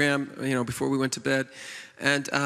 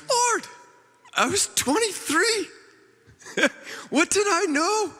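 much higher, mock pleading whine; whiny excuse. Pastor mocking his own excuses to God, high whiny plea. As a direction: squeak out a feeble excuse to God. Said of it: "tried to immediately make excuses".

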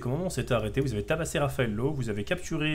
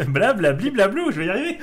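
laughing; 12 semitones higher, 6 dB louder than usual. Real, not acted. Tongue-twister game, laughing at himself.

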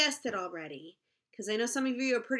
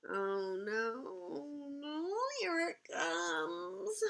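mock dread; 4 semitones higher, 8 dB quieter, much slower than usual. Dreading the silly sounds.

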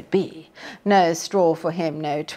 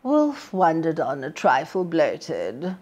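ordinary delivery

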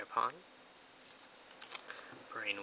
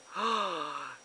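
high sudden gasp; sharp excited gasp; 5 semitones higher, 4 dB louder than usual. Real, not acted. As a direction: gasp at the reveal. Big card reveal.

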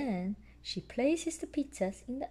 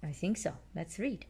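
plain reading voice

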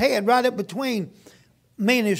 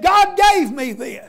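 slow, high and louder, beaming with joy; overjoyed thanks for his car; joyful exclaim; 5 semitones higher, 4 dB louder, slower than usual. Retold or imitated. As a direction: proudly show off the gift from God. Grateful man shows off his car.

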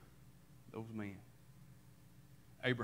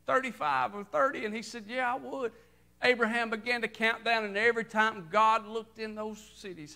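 high, loud preacher's cadence; rising fervent sermon delivery; full-force preaching; 8 semitones higher, 5 dB louder than usual. Acted. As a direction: preach it out to the congregation.

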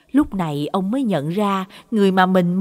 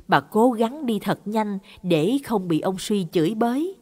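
ordinary delivery, nothing marked

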